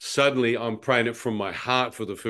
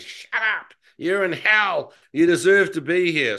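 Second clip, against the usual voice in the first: high, harsh retold yell, louder by the end; men screaming abuse; 6 semitones higher, 4 dB louder than usual. Tormented men in the darkness yelling at him, harsh shout. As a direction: spit it out like a tormenting demon. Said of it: "I began hearing men scream from the darkness".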